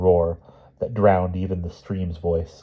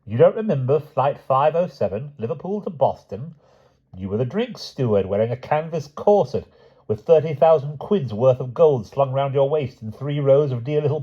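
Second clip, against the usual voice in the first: higher, mocking villain taunt; menacing villain voice; 8 semitones higher, 3 dB louder than usual. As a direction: sneer it like a gloating enemy. Harbin taunts Wilby.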